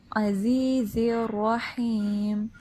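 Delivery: slow chanted "عزیز الرحیم" with a full, heavy r; tongue root up for a heavy r in "الرحیم"; heavy raa. Shown as a demonstration. Teacher modelling "عزیز الرحیم", heavy raa, slow chant. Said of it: "heavy پڑھتے ہیں".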